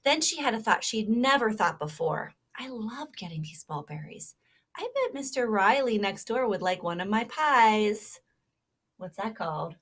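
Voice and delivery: lively storybook read-aloud; reading to children. Acted. Teacher reading a kindness story to children, animated. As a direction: read it like a picture book to little listeners.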